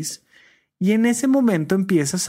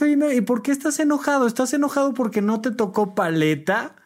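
higher, fast gentle questioning; parent asking; 6 semitones higher than usual. Retold or imitated. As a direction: quickly probe why the child is angry. Parent questioning angry child.

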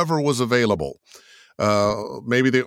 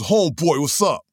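homeboy voice; 4 semitones higher than usual. Retold or imitated. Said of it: "the third one, it was".

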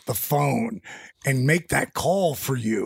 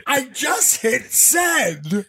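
excited squeal; 8 semitones higher, much slower than usual. Retold or imitated. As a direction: squeal it, stretched out slowly. Bonnie blurts out her excuse.

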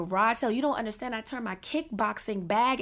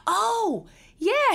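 normal talking voice

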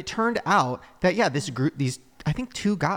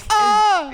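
high, loud mock scream; child's horrified scream; 8 dB louder than usual. Eight-year-old seeing parent's new face, loud scream. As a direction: shriek like a horrified kid. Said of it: "walks in the room and goes".

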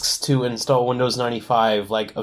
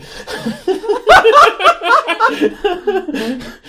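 big high burst of laughter; loud laugh; 8 dB louder than usual. Real, not acted.

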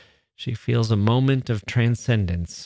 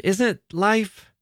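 much higher, halting second attempt; stammered; 10 semitones higher than usual. A narrator's reading. Sister struggling to explain to her brother, high and faltering. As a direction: reach high for words that won't come. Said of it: "she stammered".

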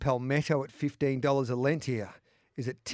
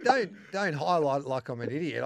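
laughing protest; 3 semitones higher, 8 dB louder than usual. Real, not acted. Ribbed over a botched pronunciation.

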